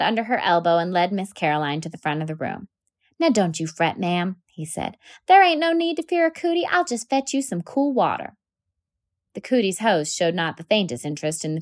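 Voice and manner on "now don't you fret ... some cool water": lightly voiced kindly boy, lifting on cootie; a boy calming his frightened teacher; comforting boy. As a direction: reassure her, easy and kind.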